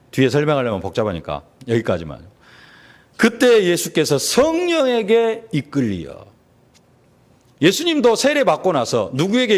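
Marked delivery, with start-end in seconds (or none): 3.2-6.2 slow, high, drawn-out recitation; slow reading aloud, lifted high for emphasis; emphatic scripture reading. Acted. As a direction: proclaim the verse slowly with raised pitch. Rereads the verse for the congregation.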